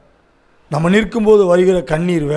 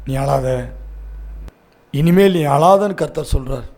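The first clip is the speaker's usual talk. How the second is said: low, softening reassurance; gentle consoling; 6 semitones lower, 3 dB quieter than usual. Real, not acted. Preacher passes on the Lord's comfort to the weeping, low and gentle. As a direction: let it sink down, tender and quiet.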